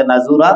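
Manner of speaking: नाजूरा with "u" in place of "a"; wrong middle vowel. Shown as a demonstration. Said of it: "नहीं पढ़े".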